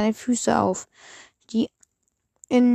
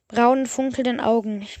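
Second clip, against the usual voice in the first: usual speaking voice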